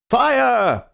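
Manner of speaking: shouted out at the top of the voice; yelled. A narrator's reading. Group raising a fire alarm, loud yell. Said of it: "we all yelled".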